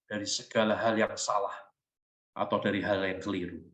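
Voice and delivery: preacher's tender inner prayer to the Father. Acted. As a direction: pray it gently, almost to yourself. Prays aloud within sermon.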